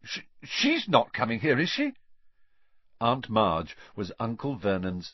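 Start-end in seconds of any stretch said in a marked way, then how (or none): 0.0-1.9 alarmed blurt. A narrator's reading. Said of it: "he blurted out".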